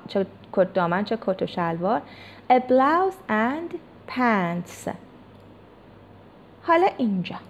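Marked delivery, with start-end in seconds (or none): 2.5-4.9 a blouse and pants drawn out very slowly; slow, careful model reading. Shown as a demonstration. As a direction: read it out word by word. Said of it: "یه بار دیگه کلمات رو براتون میخونم".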